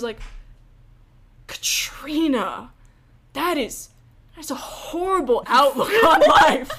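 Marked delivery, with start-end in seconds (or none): 1.5-6.7 imitating appalled dad. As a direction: build mock outrage, laughing near the end. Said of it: "he starts in on this huge lecture".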